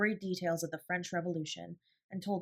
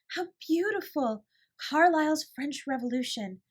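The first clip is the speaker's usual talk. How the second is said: delighted cry; 8 semitones higher, 5 dB louder than usual. A narrator's reading. Sarah finding Carlyle on the table, delighted cry. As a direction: gush over the book like a treasure. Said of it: "she cried out".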